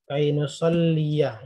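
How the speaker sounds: nusalliya said slowly, stretching the final -ya; teacher stretching nusalliya's ending. Shown as a demonstration. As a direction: draw out nusalliya so the ending fathah is heard. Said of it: "Ya-nya saja awal".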